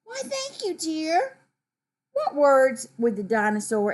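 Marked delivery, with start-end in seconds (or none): 0.0-1.4 smiling thanks. Acted. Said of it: "She smiles and says".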